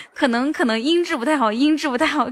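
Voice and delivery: repeating herself through laughter; giggling as she says the line twice; laughing repeat. Shown as a demonstration. Laughing at catching her friend's repeat habit. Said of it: "说话喜欢说两遍".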